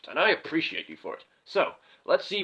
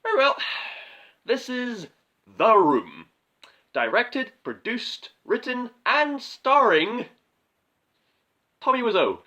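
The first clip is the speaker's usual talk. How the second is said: louder, higher showman's intro; grand announcement; 5 semitones higher, 5 dB louder than usual. Acted. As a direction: announce it like a trailer voice.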